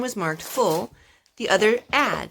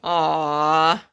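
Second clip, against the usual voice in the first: aww and laugh; 4 dB louder than usual. Real, not acted. Reacting to sweet brothers.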